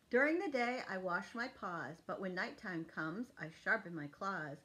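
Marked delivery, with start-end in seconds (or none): none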